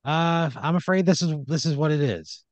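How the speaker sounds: high, mock-apologetic owner voice; unapologetic boss. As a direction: give a hollow apology as the boss. Laughing owner refuses the complaint.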